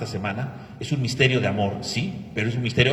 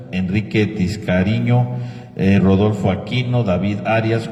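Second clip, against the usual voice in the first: louder, steady list of names; reading names; 2 semitones lower, 6 dB louder than usual. Acted. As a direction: read out the names one by one. Priest reads names of deceased.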